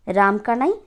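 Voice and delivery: usual speaking voice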